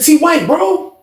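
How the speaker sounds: hyped-up hey bro; excited greeting. Retold or imitated. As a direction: greet him like a long-lost friend. Calling out greeting to old friend.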